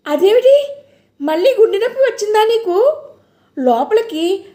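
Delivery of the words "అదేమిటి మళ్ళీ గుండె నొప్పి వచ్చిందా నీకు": high, alarmed cry of worry. A narrator's reading. Jayanti finding grandma collapsed, alarmed high cry. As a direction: panic breaking into the words.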